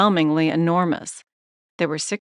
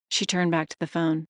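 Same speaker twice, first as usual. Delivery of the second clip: usual speaking voice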